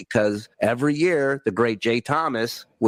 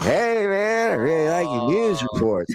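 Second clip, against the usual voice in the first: friendly Norm compliment; 4 semitones higher than usual. Retold or imitated. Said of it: "And he's like".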